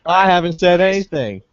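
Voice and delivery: high mock-indignant protest. Captive answers being told to shut up, indignant.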